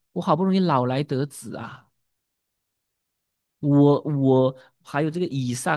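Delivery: usual speaking voice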